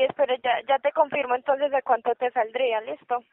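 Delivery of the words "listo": ordinary delivery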